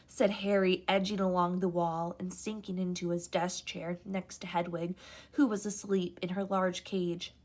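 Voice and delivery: ordinary delivery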